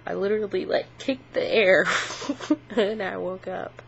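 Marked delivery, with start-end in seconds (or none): 1.9-2.7 short laugh. Real, not acted. Laughing at kicking in sleep.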